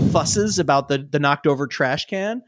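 ordinary delivery

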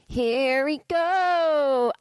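very slow, high stretched here we go; drawn-out singsong. Real, not acted. Starting the episode, slow singsong here we go. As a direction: stretch it out playfully. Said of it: "talk like that?".

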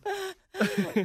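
shrieking giggle; high-pitched burst of laughter; high squealing laugh. Real, not acted. Laugh alone.

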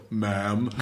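deep "Ma'am," said low and laughing; super deep voice. Retold or imitated. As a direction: drop way down for "Ma'am,". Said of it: "this super deep voice".

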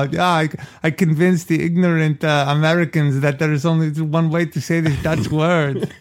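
put-on smug Dutch accent. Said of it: "I love your, Dur-, your Dutch accent".

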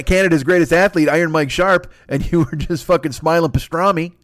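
ordinary talk

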